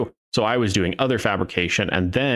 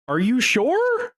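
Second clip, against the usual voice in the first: much higher, slow are you sure; teasing doubt; slower than usual. Retold or imitated. Teasing the boss about firing, high and slow. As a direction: stretch it out in mock doubt. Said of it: "I was like".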